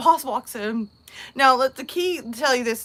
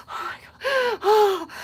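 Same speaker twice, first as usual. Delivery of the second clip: high, drawn-out gasping mock swoon; swooning coworker; 12 semitones higher, much slower than usual. Coworker over here dying at the sight, mock swooning. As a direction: gasp it out like you are dying. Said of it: "apparently my coworker is dying".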